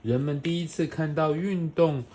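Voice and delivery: put-on bright, animated read-aloud for a small child; reading to a toddler. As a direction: read it like a bedtime picture book. Parent reading picture book to child.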